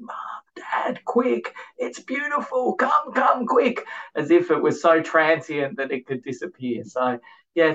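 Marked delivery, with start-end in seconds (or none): 0.0-3.8 high-pitched mock scream of an excited child; imitated excited screaming. Small boy calling Mom and Dad to see the blossoms, excited screaming. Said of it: "ran off screaming".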